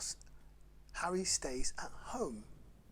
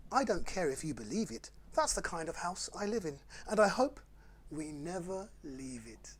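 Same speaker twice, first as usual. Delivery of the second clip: lively, sing-song picture-book read; bouncy storytime rhyme reading; reading to children; 6 dB louder than usual. Acted. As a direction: read it like bedtime fun for little ones. Reads Dr Seuss to children.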